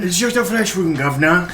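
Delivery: high, put-on cartoon voice; parroting the line as the character; goofy high character voice; the silly voice. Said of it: "ability to do the voice".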